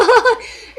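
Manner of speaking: loud, high burst of laughter; real laugh. Real, not acted. Recalling being told to diet, laughing loudly.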